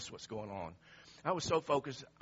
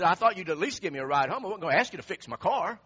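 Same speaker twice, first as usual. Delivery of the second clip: imitating loud, indignant reproach; mad coworker; 4 semitones higher, 11 dB louder than usual. Stranded coworker scolding the man who passed him, loudly indignant. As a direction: lay it on loud and hard. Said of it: "it really made him mad".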